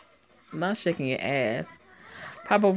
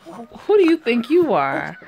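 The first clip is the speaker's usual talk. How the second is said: slow, louder challenge; angry challenge; 6 semitones higher, 5 dB louder than usual. Acted. Woman confronting a stranger in her house, angry and slow. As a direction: draw each word out, squaring up.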